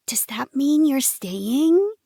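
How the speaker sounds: put-on slow, high childlike hope; hopeful little girl. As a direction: ask like an eager little girl. Child hopeful, voiced by narrator.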